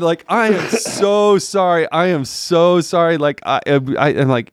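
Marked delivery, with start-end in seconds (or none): 0.3-3.2 slow, much higher embarrassed apology; apologetic dad. Retold or imitated. Dad apologizing to host for his kids, slow high apology. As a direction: play the flustered dad saying sorry. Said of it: "here comes Dad".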